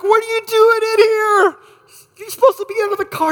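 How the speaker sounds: high, loud panicked questioning; freaking out. Retold or imitated. Wife confronting husband in kitchen, panicked and high. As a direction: blurt it out in a frantic rush. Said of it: "She is freaking out".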